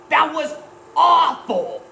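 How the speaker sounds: high, loud disgusted outburst; hammed-up angry yell; outraged. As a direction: let your outrage burst out. Repeats outrage after the movie.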